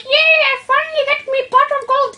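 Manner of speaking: high gleeful leprechaun voice. Retold or imitated. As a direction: cheer like a cartoon leprechaun. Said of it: "and it's like".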